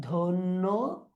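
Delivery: ধন্যবাদ broken as "dhon-no... baad"; syllable-by-syllable model. Shown as a demonstration. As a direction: stretch ধন্যবাদ, pausing before the last syllable. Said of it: "তারপর আমরা যাই ধন্যবাদ".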